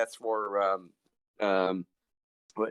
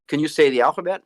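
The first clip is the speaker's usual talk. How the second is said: usual speaking voice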